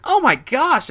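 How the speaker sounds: high, loud exasperated gasp; exasperated oh my gosh. Retold or imitated. Narrator fed up with the drunk woman, high exasperated exclamation. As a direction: throw up your hands in disbelief.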